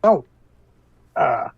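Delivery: talking through a mouthful; words garbled by food; mouth full. Acted. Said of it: "talking with his mouth full".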